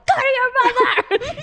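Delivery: high-pitched order to a duckling; retold frantic yell. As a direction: shoo it off in comic panic. Yelling at the duckling she threw.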